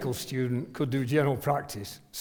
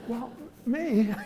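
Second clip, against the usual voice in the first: higher, squeaky disbelief with a laugh; mock alarmed surprise; 7 semitones higher than usual. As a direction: play the panicked rookie, half laughing. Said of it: "I'm going".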